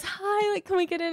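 high, flirty, soft sweet greeting; flirty sister. Retold or imitated. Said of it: "She goes".

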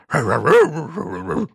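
high braying hee-haw; donkey bray. A narrator's reading. Elvis brays as he leaps to defend, donkey bray. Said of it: "brayed Elvis".

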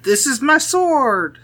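high, slow mock declaration; imitating Vagon. Recalling the montage for friends, high slow mimicry. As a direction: draw it out high and put-on. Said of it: "Vagon's like".

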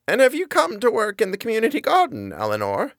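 put-on high, sweet old lady voice; an old woman's high, quavering apology. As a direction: play a frail, kindly granny.

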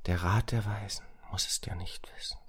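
soft, secretive whisper. A narrator's reading. Racket confides in Samira's ear, whispering. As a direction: whisper it as a private conspiracy. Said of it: "flüsterte Racket Samira leise ins Ohr".

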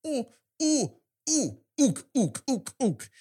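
high, hammed-up monkey hoots; ape-like grunts. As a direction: hoot it out like a mock ape. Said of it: "the other guy goes".

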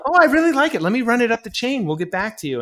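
high, loud, gushing mock of a producer brushing him off; sarcastic imitation of a studio brush-off. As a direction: ham up the fake enthusiasm, then let it drop. Mocking a producer's empty praise.

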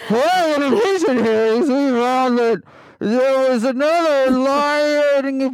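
high, pompous radio intro as Giuliani; Rudy Giuliani voice. Pretend. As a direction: announce yourself like a bumbling Rudy. Continues Giuliani bit.

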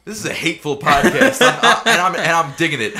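laughing loudly while talking. Real, not acted. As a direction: get it out through laughter. Laughing at the hateful podcast.

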